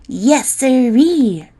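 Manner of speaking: slow, gleeful chuckle through the words; chortling. Acted. Boy chortling to his friends, slow and gleeful. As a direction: chortle it out, pleased with himself. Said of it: "chortled the boy named Rick".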